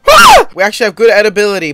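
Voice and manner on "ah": very high, loud scream; excited scream. Real, not acted. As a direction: let out an excited shriek. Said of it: "I'm just excited".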